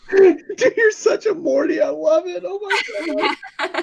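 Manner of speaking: high, laughing teasing. Real, not acted. Player cracking up at the runner, laughing. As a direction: laugh through it.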